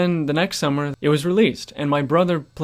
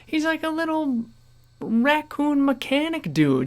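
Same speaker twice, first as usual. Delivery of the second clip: very high, stretched-out insistence; exasperated protest; 12 semitones higher than usual. Real, not acted. Gamer insisting who the raccoon mechanic is, exasperated. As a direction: plead the obvious in a soaring, incredulous pitch. Baffled rant about game voice.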